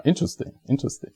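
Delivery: quick whispered interesting, interesting; whispered aside. Real, not acted. Narrator whispering to viewers about a suspicious coincidence, quick whisper. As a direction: whisper it to yourself, fast.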